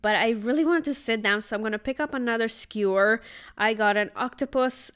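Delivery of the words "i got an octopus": ordinary talk, nothing marked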